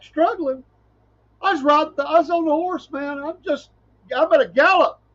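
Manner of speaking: high, incredulous protest; indignant surprise. Retold or imitated. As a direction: protest that you were doing great. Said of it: "And you're like".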